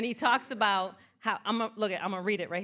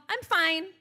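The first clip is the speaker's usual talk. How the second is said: high, bright-sounding I'm fine that hides the truth; chirpy fake fine; 9 semitones higher, 8 dB louder than usual. Mocking how Americans answer greetings, chirpy fake fine. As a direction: toss off I'm fine like a reflex. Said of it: "we tend to go".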